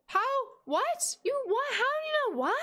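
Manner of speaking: high, startled cries of what; shocked that chat guessed right; startled disbelief. Real, not acted. Streamer stunned that chat guessed the tacos, high disbelieving cries.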